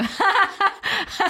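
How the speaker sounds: high Joker-like cackle; maniacal laugh. Acted. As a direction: laugh at everything like a freak. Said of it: "So wie der Joker".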